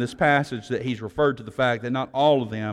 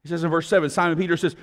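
normal talking voice

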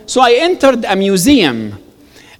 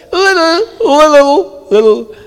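higher-pitched mimicry of the receptionist; imitated coy modesty; 6 semitones higher than usual. British convert admits to some Arabic, imitated coy modesty. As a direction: play her modest little answer up high.

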